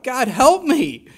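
loud, slow plea to God; crying out. Acted. Preacher crying out to God for help. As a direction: cry out for help, drawn out. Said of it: "I cry".

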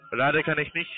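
ordinary delivery

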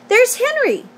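put-on very high, girlish exclamation; excited cry. A narrator's reading. Fern sees Henry at the fair, excited cry. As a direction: point out a friend with an eager cry. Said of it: "cried Fern, pointing".

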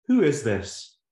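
irritated man on phone. Acted. Man answering phone again.

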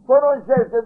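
high, loud eager reply; boy calling back, high and loud; child answering God. Retold or imitated. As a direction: answer the call at once. Child answers God's call.

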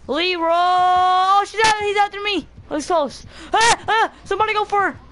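panicked yelling. Real, not acted. Teammate hooked, urgent calling out.

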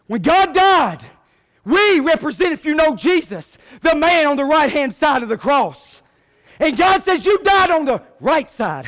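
loud, high, punched sermon delivery; full-force preaching. Acted. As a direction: preach it out with rising and falling cries.

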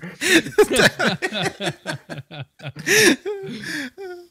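high hammed-up villain cackle; cackling villain, loud and high; evil laugh. Villain laugh after attack.

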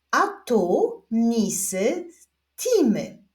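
slow word-by-word reading; measured beginner's reading with spaced words; reading demonstration. Shown as a demonstration. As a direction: read aloud slowly for learners to follow. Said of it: "zapraszam Was do odczytywania zdań".

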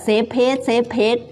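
"safe place" as a wrong model, missing the F and L; learner's-error "safe place", like "sep pet"; F and L dropped. Shown as a demonstration. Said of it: "ไม่ใช่แบบ".

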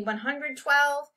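high, drawn-out taunt. A narrator's reading. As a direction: stretch the number out slowly to goad him. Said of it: "taunted Jeremy".

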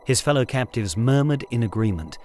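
ordinary delivery

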